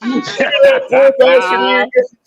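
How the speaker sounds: high, slow mocking sneer; high mock pity for the kid, with a laugh at the end. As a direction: sneer at the poor kid, dragging it out. Mocking kids at school shopping.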